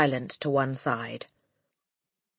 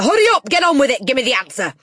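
high, rapid, impatient shout; Trunchbull; 10 semitones higher, 9 dB louder, much faster than usual. A narrator's reading. Headmistress rushing a boy for his answer, impatient shouting. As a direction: fire it off fast and loud.

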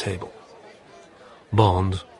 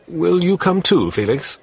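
Bond asking; 10 semitones higher, 5 dB louder than usual. Acted. Bond asks Felix along.

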